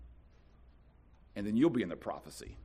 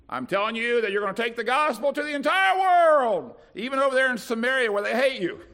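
much higher and louder, ringing declaration; Jesus' bold commission; 10 semitones higher, 8 dB louder than usual. Acted. Jesus to frightened disciples, ringing bold commission. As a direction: proclaim it like a sweeping promise. Jesus voiced in a retold scene.

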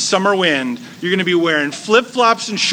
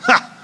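scoffing laugh; 6 semitones higher than usual. Retold or imitated. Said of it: "She laughs".

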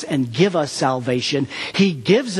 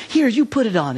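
encouraging; 7 semitones higher than usual. Retold or imitated. Pastor hands girl handle.

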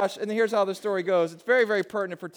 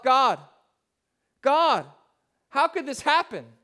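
anguished cry to God; 4 semitones higher, 4 dB louder than usual. Retold or imitated. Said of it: "And I said to myself".

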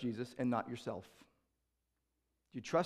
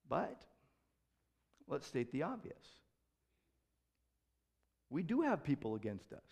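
ordinary talk

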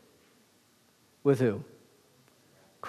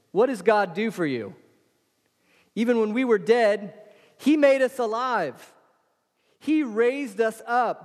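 higher, fervent preacher's delivery; full-force preaching; 8 semitones higher, 3 dB louder than usual. Acted. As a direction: preach it out to the congregation. Preacher's rising fervour.

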